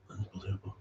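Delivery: soft muttered repeat. Real, not acted. Muttering to himself about sponsors, soft. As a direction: say it under your breath.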